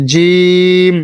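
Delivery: জিম very slow and drawn out; letter jim demo. Shown as a demonstration. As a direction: hold জিম long and clear. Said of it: "জিহ্বার মধ্যখান থেকে".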